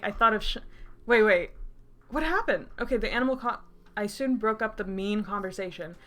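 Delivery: normal talking voice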